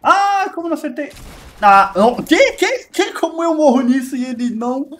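high, loud disbelieving cry; real gamer rage; frustrated outburst. Real, not acted. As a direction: yell at the screen in disbelief. Dying in game, frustrated.

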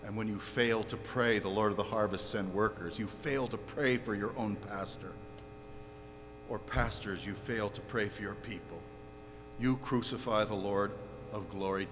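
very low, soft indictment; grave hushed accusation. Real, not acted. Preacher charging pastors and people who fail to pray, grave and hushed. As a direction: bring it down almost to a murmur.

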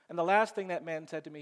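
ordinary delivery

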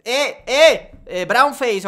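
imitando a la gente indignada, más agudo y más fuerte; gritos burlones imitados. Retold or imitated. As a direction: remeda a los ofendidos con un chillido exagerado. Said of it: "y la gente dijo". (burla de la multitud que acusa de brownface, imitando gritos agudos)

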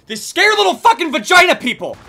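a real outraged shout; angry yell. Real, not acted. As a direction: yell it high and loud at the hiding enemies. Yelling at campers in match.

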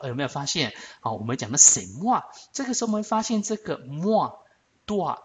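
ordinary talk

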